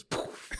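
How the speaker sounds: soft, airy puff sound; imitated poof. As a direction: blow out a little magic poof. Imitates magician vanishing.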